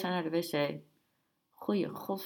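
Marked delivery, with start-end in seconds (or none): 1.5-2.2 hushed, softly voiced exclamation; a touch of a whisper, kept soft. A narrator's reading. Man exclaims to companions over a woman, whispered. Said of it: "fluisterde hij".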